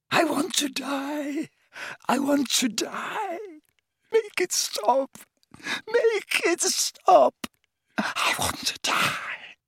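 very high, slow wail ending in a whisper; anguished wail. A narrator's reading. Tortured old man wailing to die, fading to whisper. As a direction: wail in despair.

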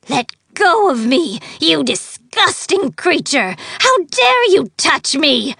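high, loud outraged fairy; furious. Acted. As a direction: spit it out in fury. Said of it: "eyes snapping with fury".

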